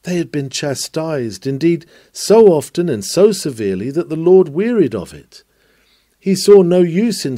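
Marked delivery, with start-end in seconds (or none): none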